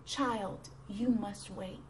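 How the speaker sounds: the master's quiet, low reassurance; gentle. Acted. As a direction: gently hush a child with it. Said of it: "the master so gently said".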